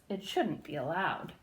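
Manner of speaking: low, soft, emphatic hiss; hissed with emphasis. A narrator's reading. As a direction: hiss it, stressing each word. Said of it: "Christa hissed with emphasis".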